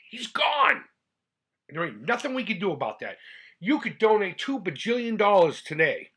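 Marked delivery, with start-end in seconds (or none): 0.1-0.8 sudden high pained outburst; anguished cry. Real, not acted. Grieving son crying out about his dad. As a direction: cry out the loss, voice cracking up. Grief over his father.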